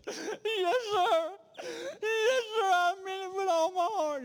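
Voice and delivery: high, crying voice breaking on each word; sobbing girl. Retold or imitated. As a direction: plead through tears, pitched high. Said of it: "She was just sobbing".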